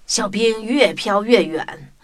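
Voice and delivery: ordinary delivery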